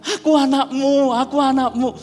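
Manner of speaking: overacted son. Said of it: "Jadi dia".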